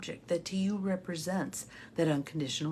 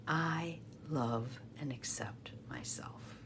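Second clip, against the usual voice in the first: quiet affirmation; 6 semitones lower, 10 dB quieter, slower than usual. Shown as a demonstration. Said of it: "It can be quiet".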